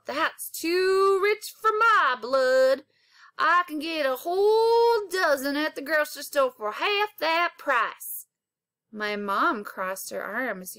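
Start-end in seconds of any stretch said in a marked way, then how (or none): 0.0-8.3 lightly voiced old man scoffing at the cost; old country farmer. A narrator's reading. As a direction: balk at the price like an old farmer. Old farmer balks at price.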